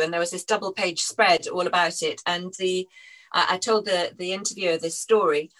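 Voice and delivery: usual speaking voice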